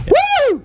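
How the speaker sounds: high celebratory "woo"; sudden high-pitched cheer; excited whoop. Real, not acted. Celebrates knowing the answer.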